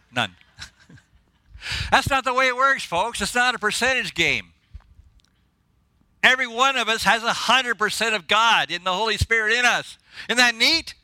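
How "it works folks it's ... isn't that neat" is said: high-pitched preacher's excitement; excited. Real, not acted. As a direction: share a truth that thrills you, pitched up. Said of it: "I'm sorry I get excited".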